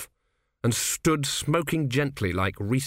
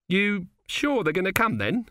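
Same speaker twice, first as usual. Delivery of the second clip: much higher, naive lad's voice; young recruit; 8 semitones higher than usual. Acted. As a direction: whine it like a green young soldier. Narrator voices Autocue.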